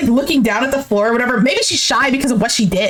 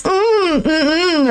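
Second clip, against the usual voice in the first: mock-cute humming; cute, mouth full; 3 semitones higher, much slower than usual. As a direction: imitate coy whining with a mouthful of water. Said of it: "She's acting all cute while having water in her mouth".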